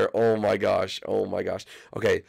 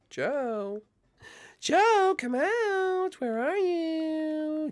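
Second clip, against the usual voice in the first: falsetto calling; much slower than usual. Acted. Calling for hiding Joe.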